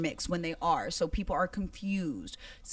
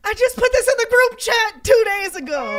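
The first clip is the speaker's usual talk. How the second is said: high, loud exasperated outburst; exasperated shout; 10 dB louder than usual. Real, not acted. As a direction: yell it in disbelief. Exasperated at being asked again.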